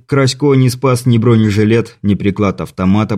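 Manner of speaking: ordinary delivery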